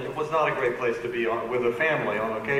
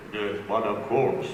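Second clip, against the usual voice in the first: Andre; 6 semitones lower than usual. Retold or imitated. Andre agreeing to visit.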